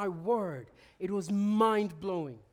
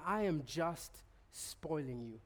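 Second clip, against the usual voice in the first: much lower, softer and slow, full of tenderness; tender divine voice; 7 semitones lower, 8 dB quieter than usual. Retold or imitated. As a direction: speak as a loving father, very gently. God's voice in Trafalgar Square.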